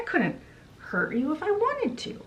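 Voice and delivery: very high, pleading assurance; acted protestation. Mother assures her daughter, high-pitched acted protest. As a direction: lift the voice right up to insist.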